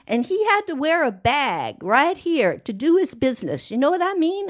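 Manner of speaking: put-on high, earnest voice of an older woman telling her past; voicing Miss Starr. Miss Starr recounting her boyfriend's shooting, high and earnest. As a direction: tell it plainly as the old woman, high. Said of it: "She goes".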